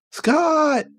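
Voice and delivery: very high hammed-up shriek; screamed name. Jean cries out to Scott in pain, very high. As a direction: cry his name in mock agony.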